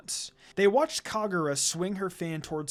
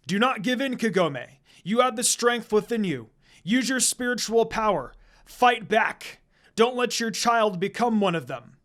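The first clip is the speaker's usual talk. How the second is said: loud, higher ringing call; shouting; 5 semitones higher, 5 dB louder than usual. A narrator's reading. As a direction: urge her on with full force. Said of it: "Inu Taisho shouted with echo".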